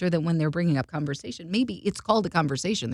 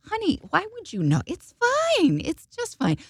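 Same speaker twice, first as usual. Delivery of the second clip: gracious star; 11 semitones higher than usual. Retold or imitated. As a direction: gently reassure the flustered actor. Gracious star reassuring teller.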